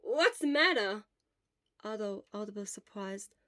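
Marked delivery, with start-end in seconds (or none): 0.0-1.0 high, loud startled friend; whoa, what's wrong with you. Acted. Obo startled when his friend suddenly hugs him.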